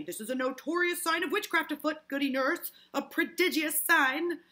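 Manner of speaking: loud, high proclamation; dramatic accusation. Acted. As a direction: announce witchcraft with great alarm. Putnam declares witchcraft, acted.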